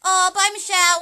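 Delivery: high, very loud friendly call. Real, not acted. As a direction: call across to someone leaving. Calling out to someone leaving.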